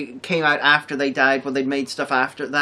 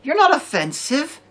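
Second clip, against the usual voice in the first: scornful retort; 10 semitones higher than usual. Retold or imitated. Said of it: "I'm like".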